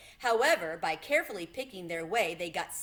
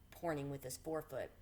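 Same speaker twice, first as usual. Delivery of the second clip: plain reading voice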